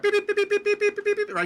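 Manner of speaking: high, quick beeping like a telegraph key; telegraph beeps. Retold or imitated. Host imitating the undersea telegraph cable, high beeping. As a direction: tap out the beeps like an old telegraph.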